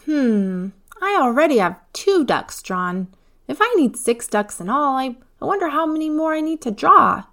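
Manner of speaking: put-on high child voice, thinking aloud; a small girl puzzling over her sum; little girl wondering. A narrator's reading. Eva counting ducks, high childlike musing.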